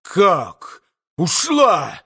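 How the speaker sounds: сдержанный вскрик изумления; переспроси поражённо, слегка повысив голос; изумлённый вскрик. A narrator's reading. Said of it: "вскричал Митя". (негромко вскрикни от неожиданности)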